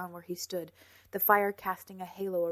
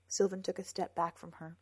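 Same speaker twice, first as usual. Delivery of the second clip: usual speaking voice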